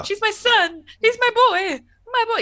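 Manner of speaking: a mother clutching her boy, hammed up; overprotective mom. Voicing protective mother Beverly.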